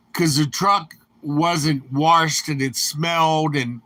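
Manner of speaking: ordinary talk, nothing marked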